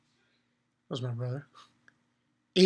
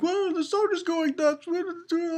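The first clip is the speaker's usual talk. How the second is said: much higher, alarmed cries; panicked townsfolk; 11 semitones higher than usual. Retold or imitated. Townspeople shocked at the soldiers, high panicked voice. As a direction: voice the frightened crowd, pitched way up. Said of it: "everyone's like".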